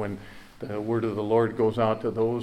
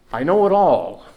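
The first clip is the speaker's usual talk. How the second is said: emphatic declaration; 4 semitones higher, 9 dB louder than usual. Acted. Jesus voiced, climax of list.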